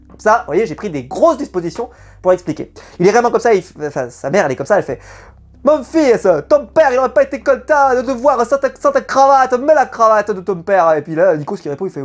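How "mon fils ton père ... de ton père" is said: mock Marseille mother, pitched higher; Marseille accent. Mother pushing her son to wear his father's tie, in a higher mock Marseille accent. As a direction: ham up a Marseille mum, higher voice. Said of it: "ce petit accent marseillais".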